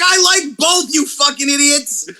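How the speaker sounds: high-pitched, fed-up protest; exasperated retort. Retold or imitated. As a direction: fire back at clueless fans. Acting exasperated reply to fans.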